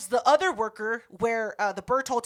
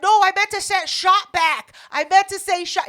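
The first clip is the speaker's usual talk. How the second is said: loud, high imitation of frantic protest; mock-panicked backpedalling, raised voice; desperate self-defence; 7 semitones higher, 7 dB louder than usual. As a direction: protest like you're fighting for your life. He was fighting for his life.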